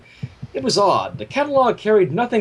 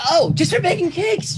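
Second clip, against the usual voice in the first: high, innocent pony voice; Pinkie lying; 12 semitones higher than usual. A narrator's reading. Pinkie covering for the flour, high and innocent. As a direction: sell the fib sweetly. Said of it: "she lied".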